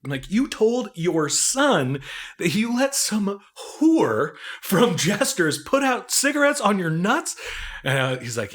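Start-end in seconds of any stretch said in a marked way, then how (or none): 0.3-7.3 higher, very slow, drawn-out disbelief; slow, high "you told your son". Retold or imitated. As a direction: spell out each word, stunned. Retells disbelief at friend.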